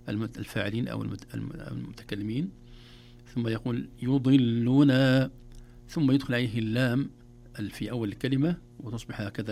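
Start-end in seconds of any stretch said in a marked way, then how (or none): 4.0-5.3 slow, louder recited word; practice drill. Shown as a demonstration. Teacher showing the second stage, slow and drawn out. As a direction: draw the word out for learners. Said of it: "ويتدرب عليه".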